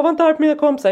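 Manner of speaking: lightly voiced child's protest. Son defending his friends to mum, imitated protest. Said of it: "Han bara".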